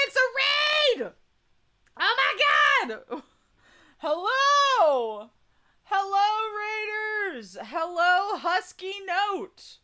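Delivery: high, squealing raid greeting; excited squeal. Real, not acted. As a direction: shriek with delight at the raid. Excited greeting of incoming raiders.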